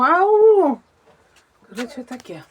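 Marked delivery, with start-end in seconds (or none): 0.0-0.8 loud, high "Ау"; "Ау" called out loud and high; calling out. Real, not acted. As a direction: call out to someone far off. Calling out in the yard.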